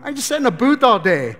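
high, loud complaining tax collector; loud, high hammed-up whine of misery; whiny seasick tax collector. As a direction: moan it like a seasick pencil pusher. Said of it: "tax collectors in there going".

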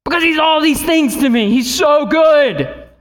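high, loud, soaring praise declaration; lifted worship preaching; full-force preaching. Real, not acted. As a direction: proclaim it to the whole church.

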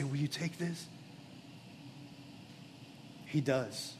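quiet pastoral appeal over music; soft altar call. Acted. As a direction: draw the listener in gently, almost confiding. Soft invitation after sermon climax.

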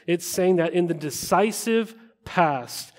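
normal talking voice

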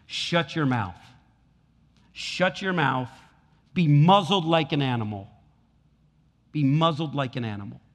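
loud, forceful rebuke of a demon; disrespectful stern command. Acted. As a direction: order it silenced, each command hammered out. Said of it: "we should never talk like this".

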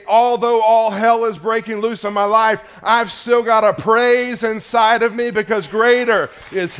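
loud, high preacher's proclamation; defiant declaration. Acted. Believer finding praise within amid trouble, loud and high declaration. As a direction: declare it at full force over the trouble.

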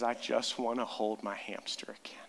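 put-on hushed, tearful child's plea; whispered crying. Pastor retells his crying daughter's words, whispered tearfully. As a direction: whisper it like a little girl crying. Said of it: "she just starts to cry".

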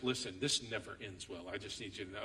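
a low, quiet, resigned husband voice; weary husband. Retold or imitated. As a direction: mutter it like a tired husband. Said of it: "And the husband goes".

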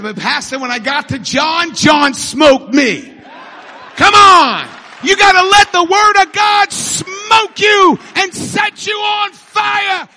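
full-force preaching. Acted. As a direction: shout building to a high peak. Punchline, then full-force preaching.

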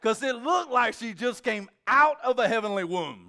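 loud, high, awestruck; nearly shouting with wonder; overwhelmed. Real, not acted. Preacher overwhelmed at baptism.

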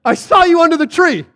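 high, loud mock amazement; amazed exclamation. Preacher marvels that this convinced Nathaniel, exclaiming. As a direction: cry it like a huge revelation. Amazed at how little it took.

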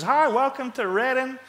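high, drawn-out mock welcome; hammed-up high-pitched airport welcome; exaggerated greeter. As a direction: overdo a woman's chirpy greeting. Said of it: "that was not how she sounded at all".

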